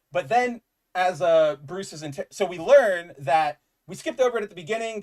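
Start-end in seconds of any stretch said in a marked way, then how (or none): none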